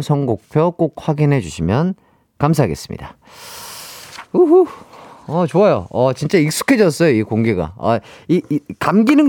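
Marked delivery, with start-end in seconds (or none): 4.3-4.7 high whooping cheer; cheerful whoop. Real, not acted. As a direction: whoop with delight. Cheer after results. (신나게 외치는 우후)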